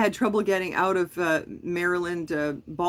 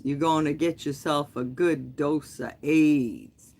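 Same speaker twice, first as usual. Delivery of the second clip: slow, lower Southern drawl, menacing trucker; imitating a southern drawl; 4 semitones lower, slower than usual. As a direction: drag the words out in a slow Southern accent. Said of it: "he had a southern drawl".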